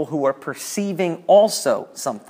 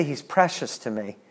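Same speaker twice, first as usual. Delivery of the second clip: tender; 2 semitones lower, 7 dB quieter than usual. Shown as a demonstration. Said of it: "But if I say".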